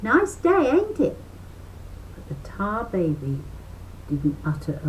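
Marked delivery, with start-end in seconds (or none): 0.0-1.2 high chirpy rabbit chatter; high-pitched folksy rabbit. A narrator's reading. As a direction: pipe up like a bouncy rabbit. Brer Rabbit voiced in story.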